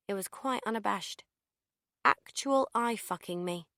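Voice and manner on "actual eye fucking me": ordinary delivery, nothing marked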